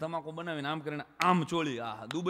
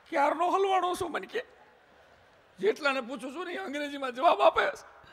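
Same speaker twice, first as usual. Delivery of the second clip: whining lost man; 11 semitones higher, 6 dB louder than usual. Retold or imitated. Lost Gujarati man complains.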